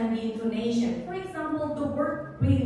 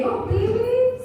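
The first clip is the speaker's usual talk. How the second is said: high, loud, pleased "really"; positive surprise; 10 semitones higher, 6 dB louder than usual. Shown as a demonstration. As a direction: react as if happily impressed. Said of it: "This is a positive thing".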